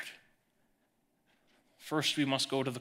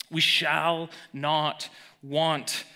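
very slow, weighed declaration; slow emphatic verse; much slower than usual. Shown as a demonstration. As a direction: spell out each word slowly. Verse stated very slowly.

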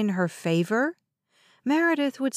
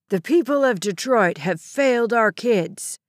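louder, accusing gunman voice; hijacker's tirade; 4 dB louder than usual. A narrator's reading. Gunman rants at Detroit over the schools, accusing. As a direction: rant at the city like a hijacker.

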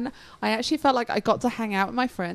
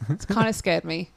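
ordinary talk

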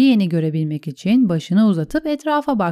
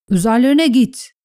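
higher, contained narrated yell; urgent battle order, mildly shouted; shouted command; 4 semitones higher, 4 dB louder than usual. A narrator's reading. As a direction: urge them to charge with a restrained shout. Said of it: "diye bağırdı".

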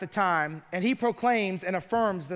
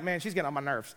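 annoyed aside; 6 semitones lower, 7 dB quieter, much faster than usual. Retold or imitated. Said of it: "He's like".

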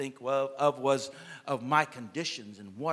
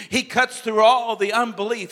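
preaching; 6 semitones higher, 8 dB louder than usual. Acted. Preaching crescendo.

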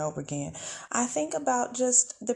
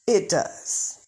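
ordinary talk, nothing marked

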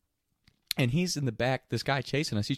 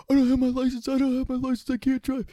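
high, frantic, pleading squeal; a scared kid who can't drive; panicked whine; 14 semitones higher, 4 dB louder than usual. Retold or imitated. Panicked friend refuses to drive.

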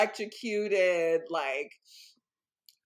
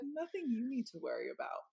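polite brush-off; 5 semitones higher, 8 dB quieter than usual. Retold or imitated. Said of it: "You just said respectfully".

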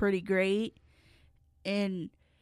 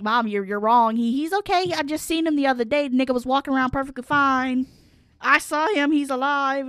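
loud, higher, insistent protest; retold denial climbing in pitch, pleading the case; getting upset; 5 semitones higher, 5 dB louder than usual. Arguing with mom about the death news, getting upset. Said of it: "starting to get upset".

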